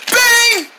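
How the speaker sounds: sharp high yelled bang; shouted bang effect. Acted. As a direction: yell bang like a gunshot.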